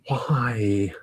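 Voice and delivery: retold inner groan, whispered and drawn out; exasperated whisper. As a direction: breathe it out as a despairing whisper. Retold exasperated thought, head in hands.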